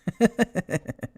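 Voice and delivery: high, loud burst of laughter; high delighted laugh; demonstration laugh. Shown as a demonstration. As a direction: show how effortless laughing is. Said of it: "I was able to laugh".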